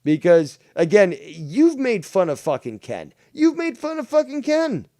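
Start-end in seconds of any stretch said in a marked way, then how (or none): 3.3-4.9 very high-pitched disbelief; incredulous accusation. Real, not acted. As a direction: repeat it shooting way up in pitch.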